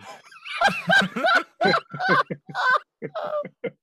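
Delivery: loud, high, prolonged laughing fit; uproarious laugh. Real, not acted. Player reacting to the retreating corpse, helpless loud laughter. Table erupts after corpse walks away.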